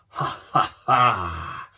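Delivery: slow, loud mocking villain laugh; the evil Snare laughs at the two boys through the TV. A narrator's reading. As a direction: ham up a gloating baddie cackle. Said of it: "laughed Snare".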